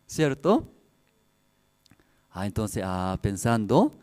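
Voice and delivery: ordinary talk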